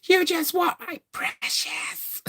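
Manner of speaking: slow whispered Gollum hiss rising to a high squeal; Gollum impression. Lily gone mad over the dagger, slow Gollum whisper then squeal. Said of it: "going nuts like Gollum".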